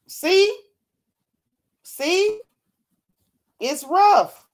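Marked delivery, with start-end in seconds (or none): 0.1-2.4 excited high. Real, not acted. Points at yawning proof.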